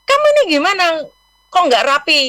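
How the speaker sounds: teguran tinggi dan keras meniru orang tua; orang tua marah. Retold or imitated. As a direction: tirukan mama yang memarahi anak karena tidak rapi. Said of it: "dia marah". (ibu menegur anak, meniru nada marah)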